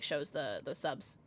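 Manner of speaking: ordinary delivery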